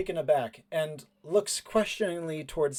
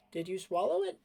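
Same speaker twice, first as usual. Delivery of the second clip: put-on astonished boy character; 5 semitones higher than usual.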